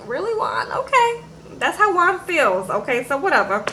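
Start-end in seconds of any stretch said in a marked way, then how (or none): 0.1-1.2 incredulous retort. Retold or imitated. Teller retorts in rising disbelief.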